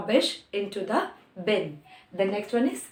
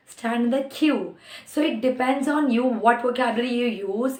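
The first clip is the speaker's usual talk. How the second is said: ordinary talk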